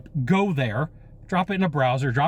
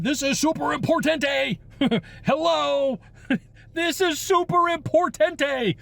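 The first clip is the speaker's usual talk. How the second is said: much higher, laughing, hammed-up emphasis; playing up the urgency with a laugh, much higher; mock super-important voice; 12 semitones higher, slower than usual. Salesman hamming up urgency to a client, much higher, laughing. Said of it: "It's like you're telling them".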